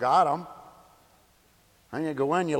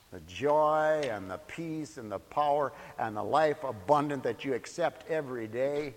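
normal talking voice

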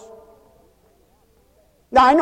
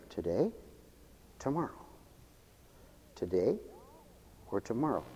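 much lower, softer wondering; wavering musing; 10 semitones lower, 23 dB quieter, faster than usual. Acted. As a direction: murmur it like a wavering mind. Mimics wavering indecision.

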